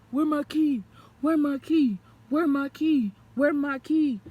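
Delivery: loud frantic demand repeated; shouting. Retold or imitated. Boyfriend yelling at her for his key, loud and frantic. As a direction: yell it over and over, frantic.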